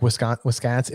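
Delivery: put-on low, soft try at a Wisconsin accent; light imitation of Wisconsin accent; Upper Midwest accent. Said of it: "that same kinda accent".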